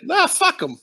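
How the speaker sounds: high, careless brush-off; mock dismissive. Promoter brushing off the band's warning, dismissive.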